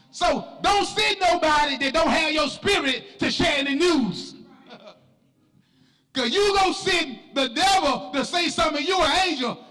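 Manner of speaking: high, driving church sermon delivery; full-force preaching. Real, not acted. Preacher exhorting the church to be different, full-force preaching. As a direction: preach it hard, stretching the phrase endings.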